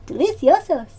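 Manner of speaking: mock Spanish word, laughing through it. Praising canned peaches, laughing mock Spanish. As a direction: savour it in playful fake Spanish, giggling.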